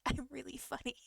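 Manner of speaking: unvoiced whisper, proud of the joke. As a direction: whisper it like a secret punchline. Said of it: "because I was talking so softly".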